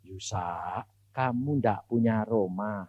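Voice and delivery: lightly voiced calm divine reply; God's voice. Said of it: "Tuhan bilang gini".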